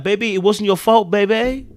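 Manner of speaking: high, loud mock consoling; pleading consolation. Viewer consoling Gemma over her loss, acted pleading.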